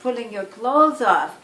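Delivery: ordinary delivery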